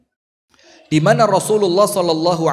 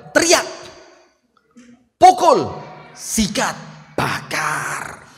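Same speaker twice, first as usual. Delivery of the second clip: devil's voice; much slower than usual. Acted. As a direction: bark them out like Satan egging you on. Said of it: "Itu saya, setan".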